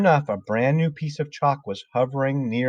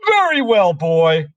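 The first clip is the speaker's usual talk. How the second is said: high, loud Trunchbull voice; domineering and imperious, pitched way up; bullying headmistress; 8 semitones higher, 8 dB louder, slower than usual. A narrator's reading. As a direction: address the boy with loud, high menace. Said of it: "she said".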